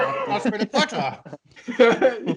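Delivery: slow, drawn-out commentary call through a laugh; mock commentator. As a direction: ham up a football commentator. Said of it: "that'd be great commentary".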